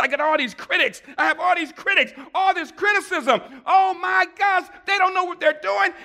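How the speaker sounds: high, loud mock-crying complaint; retold tearful complaint. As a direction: act out a distraught phone plea, pitched high. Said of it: "I called Marshall crying and crying".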